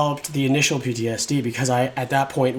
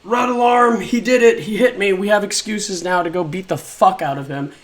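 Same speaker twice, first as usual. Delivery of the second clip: higher and louder mock announcement; loud mocking alarm call; mocking gleeful staffer; 7 semitones higher, 6 dB louder than usual. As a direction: parody the staff's eager excuse to fight. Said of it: "essentially it was like".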